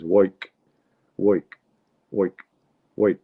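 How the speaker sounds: "woik" short, with an oy vowel; New York "woik". Retold or imitated. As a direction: clip work down to a quick "woik". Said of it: "for work, he says".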